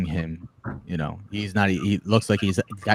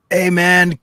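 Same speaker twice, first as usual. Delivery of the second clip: imitating the beggar; 8 semitones higher, 6 dB louder than usual. Wheelchair man asks for coffee.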